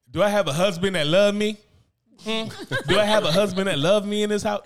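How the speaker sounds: high, loud whiny plea; imitating needy wife. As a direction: ham up the wife begging for love. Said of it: "She got".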